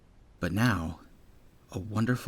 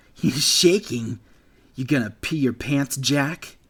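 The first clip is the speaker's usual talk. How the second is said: put-on a sneering young bully, higher and louder; taunting bully; 4 semitones higher, 5 dB louder than usual. Bully Gale mocking the frightened bunny, sneering and loud. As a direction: jeer at the trembling kid.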